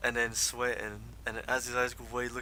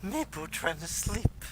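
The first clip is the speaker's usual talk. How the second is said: high-pitched kobold, simple halting speech; 7 semitones higher than usual. Acted. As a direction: play a sleepless kobold in a high voice. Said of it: "he says".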